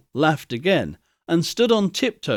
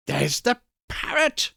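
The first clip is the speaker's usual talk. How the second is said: higher, delighted exclamation; excited cry; 8 semitones higher, 3 dB quieter than usual. A narrator's reading. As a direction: point it out with glee. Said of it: "cried Scrooge".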